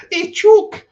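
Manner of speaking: very high, loud mock Scouse call; Liverpool accent. Imitating the Liverpool guest's comeback, mock Liverpool accent. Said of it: "or whatever he's saying in Liverpool".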